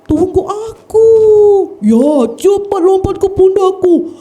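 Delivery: high, loud monkey cry for help; monkey shouting. Acted. Monkey begging the buffalo to wait, high loud call. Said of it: "monyet berteriak".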